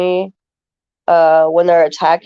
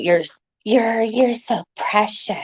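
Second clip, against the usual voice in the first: higher, slow, fawning drawl; creepy admirer; 5 semitones higher, 4 dB quieter, slower than usual. Retold or imitated. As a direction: imitate a creepy guy cooing. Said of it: "and then tell me".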